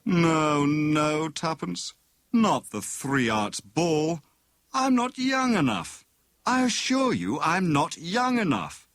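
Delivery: a hammed-up tired husband pleading; groaning protest. A narrator's reading. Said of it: "Tommy groaned".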